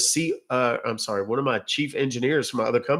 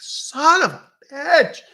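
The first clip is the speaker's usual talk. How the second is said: high, drawn-out mock yell; hammed-up cry of frustration, slow and loud; exasperated outburst; 6 dB louder, much slower than usual. Author retelling finding a typo after publishing, exasperated mock yell.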